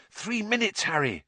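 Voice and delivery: high urgent warning. A narrator's reading. Hermione checking her watch, urgent warning to Harry. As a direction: warn him time is short.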